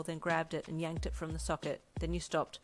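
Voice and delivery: plain reading voice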